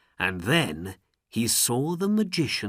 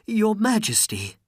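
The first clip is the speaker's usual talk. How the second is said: pleading; 11 semitones higher, 4 dB louder than usual. Acted. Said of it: "There were tears in his eyes".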